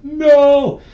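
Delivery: loud, high cry of dismay; anguished yell. Retold or imitated. Fan reliving the fourth-quarter collapse, loud anguished yell. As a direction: yell it as the game slips away. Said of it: "you're like".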